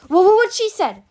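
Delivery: high, loud stammering yelp. A narrator's reading. Captive seizes on a defence, yelping. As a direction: yelp it, voice shooting up. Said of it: "Pirate yelped".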